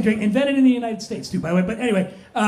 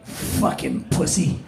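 slow, hushed and breathy taunt; a drunk woman's slow, whispered jeer; mocking insult; 7 semitones lower, 6 dB quieter, slower than usual. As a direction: sneer it under your breath, slow. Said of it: "And she goes".

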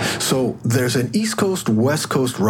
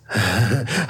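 amused chuckle. Acted. Examiner amused.

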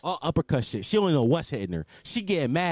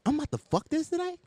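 incredulous; 11 semitones higher than usual. Retold or imitated. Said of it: "I'm like".

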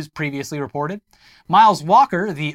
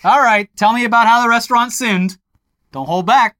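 priest voice; 4 semitones higher, 7 dB louder than usual. Pretend. Priest urging confession.

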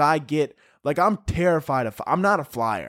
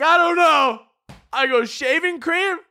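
mock panicked yell; 12 semitones higher, 4 dB louder than usual. Cutting the agent off.

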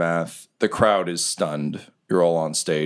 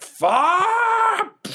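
drawn-out high yell; a slow-motion cry of anguish; slow-motion scream. A narrator's reading. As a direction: stretch the curse out in slow motion. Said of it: "Alan's just like".

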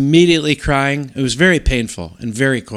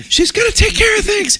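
retold yelling. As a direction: yell reassurance to the kids in the storm. Yelling to kids in blizzard.

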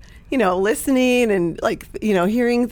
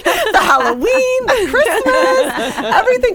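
squealing with real enthusiasm; gushing excitement; 5 dB louder than usual. Real, not acted. Gushing over the neighborhood's holiday events.

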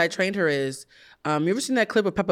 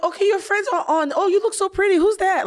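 imitating mom; 13 semitones higher, 3 dB louder than usual. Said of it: "She'd be like".

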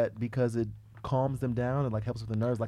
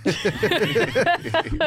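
laughing; 7 semitones higher, 5 dB louder than usual. Real, not acted. Laughing at host's remark.

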